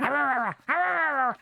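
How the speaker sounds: high little dog growls; imitated dog growl. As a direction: growl like a tiny would-be predator. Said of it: "And then she'd be like".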